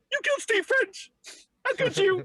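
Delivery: high squeaky wailing character voice; cartoonish character voice. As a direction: wail it like a heartbroken sitcom character. Quoting Trailer Park Boys cat scene.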